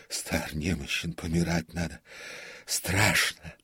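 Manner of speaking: faltering lament rising into fear; delirious muttering. A narrator's reading. Old comic tells prompter he fears death, raving. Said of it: "бормотал он, как в бреду".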